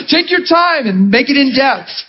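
high-pitched, amused mock eagerness; eager encouragement. Preacher retelling how he urged a child to keep talking, eager. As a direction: coax the speaker to keep going, playfully. Acted eagerness to hear accent.

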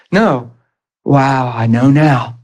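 ordinary delivery

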